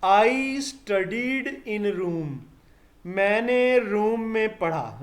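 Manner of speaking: I studied in room spelled out word by word, very slow; very slow example. Shown as a demonstration. Teacher dictates an example sentence to students, very slow. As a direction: dictate the sentence slowly for the class. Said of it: "देखें अभी पता चल जाता है".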